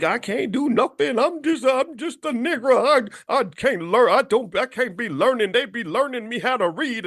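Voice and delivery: high, whiny mock victim voice; hammed-up helpless self-pity. Mocking those who think they can't learn, in a whiny voice. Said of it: "you know, and like".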